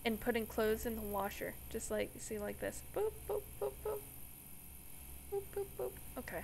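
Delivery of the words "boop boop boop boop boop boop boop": button boops. Pretend. Mimics pressing washer buttons.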